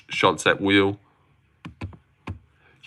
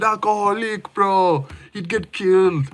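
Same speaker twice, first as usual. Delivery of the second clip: high, put-on whiny voice; mocking the streamer; 10 semitones higher than usual. Streamer imitates a streamer reacting to alcohol, high mocking voice. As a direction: squeak it like a mocking kid. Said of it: "Oh, he said".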